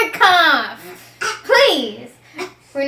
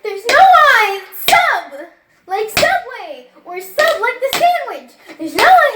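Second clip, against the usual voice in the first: loud, higher, slow exasperation; nearly shout, losing it; exasperated teacher; 6 semitones higher, 6 dB louder than usual. Acted.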